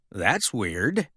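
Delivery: soft, low mutter to himself. A narrator's reading. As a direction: mumble it, puzzled. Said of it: "Mater muttered".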